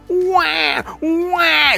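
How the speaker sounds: high, shouted mock baby cry; hammed-up baby wah; baby wailing. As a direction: wail like a crying baby. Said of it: "the constant crying".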